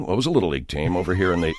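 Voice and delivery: low, quick, quiet admission; earnest team coach. Acted. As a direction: slip it in softly, as if it were perfectly normal. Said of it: "getting a guy on the radio and saying".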